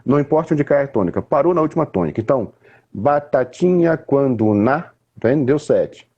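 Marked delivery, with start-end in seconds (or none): 2.9-4.8 slow, syllable by syllable on "batatinha quando na"; counting syllables. Shown as a demonstration. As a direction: tap out each syllable of "batatinha quando na" slowly. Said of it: "conta nos meus dedos". (contando as sílabas devagar)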